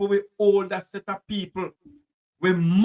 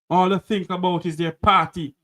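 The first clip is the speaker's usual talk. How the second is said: normal talking voice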